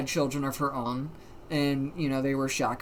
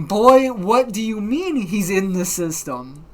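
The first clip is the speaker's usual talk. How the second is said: raised, loud, slow mock disbelief; baffled outcry; 4 semitones higher, 7 dB louder than usual. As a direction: demand an explanation, stretching the words out. Retold baffled outcry from the call.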